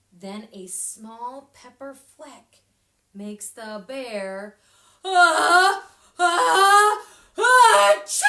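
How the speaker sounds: slow build to a huge sneeze; ah-ah held then a loud achoo burst. Acted. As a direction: stretch the ah, ah, then explode into achoo. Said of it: "Let's read that again".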